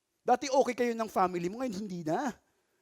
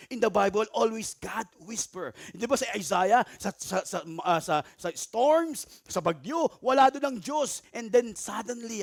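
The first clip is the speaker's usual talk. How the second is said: usual speaking voice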